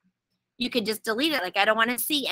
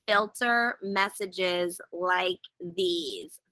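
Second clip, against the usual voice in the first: slow reading; 3 semitones lower, 5 dB quieter, much slower than usual. Shown as a demonstration. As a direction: slowly read out the menu item. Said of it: "let me do this slowly".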